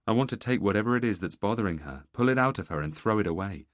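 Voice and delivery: plain reading voice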